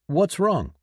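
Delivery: high, loud worried call; worried yell. A narrator's reading. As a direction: call out, alarmed. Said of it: "Ryun yelled out ... his voice worried".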